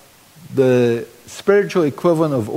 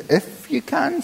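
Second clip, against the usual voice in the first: incredulous echo; 11 semitones higher, 5 dB quieter than usual. Acted. Jesus quoting the father, incredulous.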